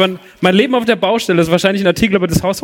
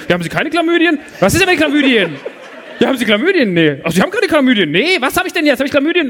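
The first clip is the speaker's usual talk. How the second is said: mock confused exchange; 8 semitones higher than usual. Confused back-and-forth with doctor.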